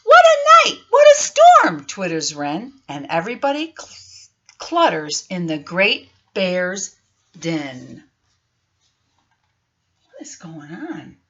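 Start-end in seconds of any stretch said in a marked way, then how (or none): 0.0-1.8 squeaky high mole voice, much louder; high squeaky storybook critter. Acted. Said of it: "Mole mutters". 10.1-11.2 much softer, low conspiratorial question; soft mock puzzled aside; hushed wonder.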